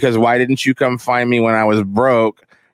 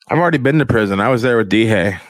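ordinary talk, nothing marked

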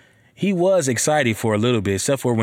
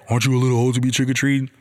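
quick, sneering imitation of a judgy homeowner; mocking snooty neighbor; much faster than usual. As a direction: toss the question off fast like a nosy neighbor. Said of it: "when somebody tell me that".

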